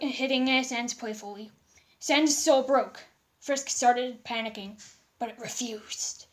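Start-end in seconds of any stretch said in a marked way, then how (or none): none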